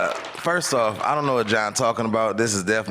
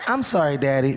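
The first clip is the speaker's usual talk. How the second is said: cute little son; faster than usual. Retold or imitated. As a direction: play the dimpled kid charming his dad. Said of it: "he just smile with his dimple".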